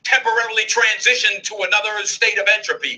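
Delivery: pointedly simple explanation. Acted. Investigator explaining a broken monitor to PhDs, pointedly simple. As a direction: spell it out for overeducated listeners. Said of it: "I'll speak slow so those of you with P H Ds in the room can understand".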